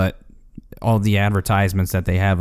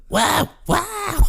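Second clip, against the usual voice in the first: very high, thin squeak; tiny squeaky voice. Retold or imitated. Co-host imitates the shrunken Beetlejuice, very high squeak. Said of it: "to, like".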